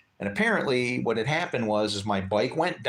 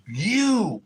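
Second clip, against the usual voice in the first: calling out; 9 semitones higher, 4 dB louder than usual. Retold or imitated. Calling out to the kid.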